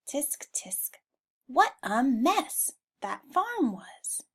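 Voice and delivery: whispered tut, then a slow dismayed sigh of a line; tut-tut at a messy farm; slow, scolding disapproval; disapproving tut. Acted. Henry tuts at the mess.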